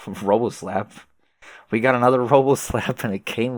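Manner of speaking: laughing through the words. Real, not acted. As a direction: chuckle as you say it. Laughing about callback.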